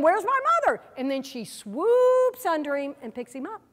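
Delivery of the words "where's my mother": much higher, mock-frantic cry for mom; a little bird calling out in mock alarm; freaked-out eaglet. The falling eaglet calling for his mother, mock-frantic. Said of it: "He's going".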